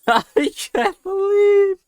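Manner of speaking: very high, cracking, close to tears; overwhelmed, laughing into crying; tearful disbelief. Real, not acted. Overwhelmed by the catch.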